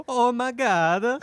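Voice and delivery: high, slow hammed-up Italian guy. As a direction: stretch it out, big Italian accent. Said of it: "in the, uh, Italian guy voice".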